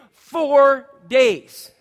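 four days called out high and loud; big preacher's exclamation; emphatic shout. Acted. Preacher stresses duration.

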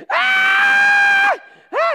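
high, loud terrified shriek; child screaming. Retold or imitated. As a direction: shriek like a frightened kid on a dark ride. Kids screaming on the ride.

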